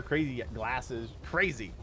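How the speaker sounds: normal talking voice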